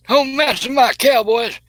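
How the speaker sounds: high, slow mock-defiant squeal; squeaky defiant. Defending team, high voice.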